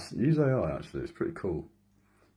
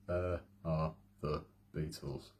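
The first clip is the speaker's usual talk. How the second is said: slower; 3 semitones lower, 7 dB quieter, slower than usual. Shown as a demonstration. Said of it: "I'll say it a bit slower".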